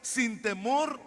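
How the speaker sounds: preacher exhorting the congregation; preaching. Acted. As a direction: drive the message home. Preaching at full force.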